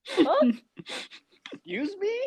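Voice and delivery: squeaky, indignant high voice; mock her as offended and shrill; a high mocking impression of Jordan Gray. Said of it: "exactly like how Jordan Gray sounds".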